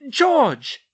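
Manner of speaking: loud, horrified call of a name; frightened cry. A narrator's reading. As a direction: cry out in shock. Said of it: "the Weasley matriarch cried".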